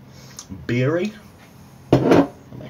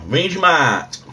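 hammed-up Aussie accent, stretched vowels swooping up high and loud; Australian accent; 8 semitones higher, 6 dB louder, slower than usual. Vlogger announcing the next taste test, in a mock Australian accent. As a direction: put on a rubbish, exaggerated Australian accent. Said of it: "That's my Australian accent. It's rubbish".

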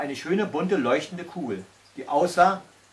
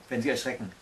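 ordinary delivery

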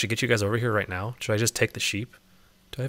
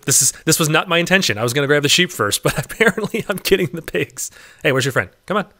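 ordinary talk, nothing marked

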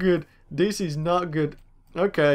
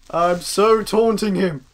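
ordinary delivery

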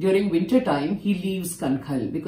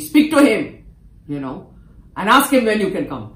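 much louder and higher, brushing it off; loud offhand order; 5 semitones higher, 9 dB louder than usual. Retold or imitated. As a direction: toss the instruction out loudly. Guru's dismissive instruction retold.

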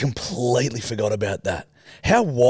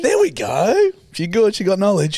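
excited praise; 8 semitones higher, 3 dB louder than usual. Real, not acted.

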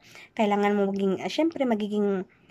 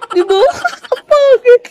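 shrieking with laughter, much higher and louder; laughing; 13 dB louder than usual. Real, not acted. Vlogger cracking up at a viewer's note, laughing loudly. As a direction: squeal it through laughter.

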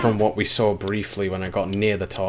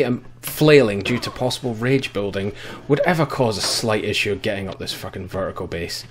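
ordinary talk

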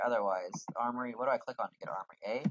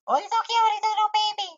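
far higher and louder squeal of delight; gushing over cute pet; 11 dB louder than usual. Real, not acted. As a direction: coo at a tiny adorable creature. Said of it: "That was so loud, I'm sorry".

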